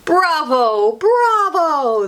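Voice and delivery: rising bravo cheer. Acted. Students cheering after the speech. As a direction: cheer the performance. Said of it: "they cheered".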